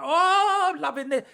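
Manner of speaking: put-on high, delighted little boy; excited child voice. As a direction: squeal it like a thrilled kid. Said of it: "I saw it all happening in his head".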